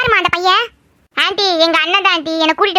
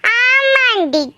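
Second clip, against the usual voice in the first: drawn-out yes; 3 semitones higher, much slower than usual. Acted. Drawn-out agreeing auntie.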